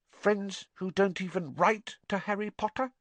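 high, sly elf voice. A narrator's reading. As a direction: slip it in slyly. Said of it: "said Dobby slyly".

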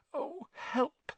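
panicked plea half-whispered; frantic whisper. A narrator's reading. Hermione beside the lashing willow, frantic high plea. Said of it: "Hermione whispered frantically".

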